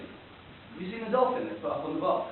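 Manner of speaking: usual speaking voice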